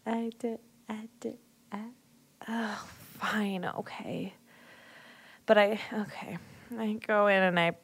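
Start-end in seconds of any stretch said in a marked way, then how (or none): 0.1-6.4 hushed, grudging whisper; reluctant whisper. Acted. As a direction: give in under your breath. Reluctantly agreeing to kiss.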